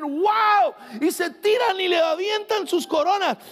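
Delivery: carried away. Real, not acted. Pastor describing heaven's worship to the church, ecstatic shouting. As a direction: get swept up picturing heaven's worship. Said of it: "Perdón, me emocioné".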